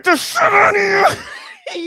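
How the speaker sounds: high, loud imitation of a tantrum; mock enraged scream. Commentator mimicking the woman's threat, exaggerated high scream. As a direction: spew it out screaming like a furious small child. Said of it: "spew their words out like a fucking five-year-old".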